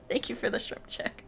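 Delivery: soft unvoiced whisper; whispered thanks. Real, not acted. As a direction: breathe the thanks out. After laughing fit, breathy thanks.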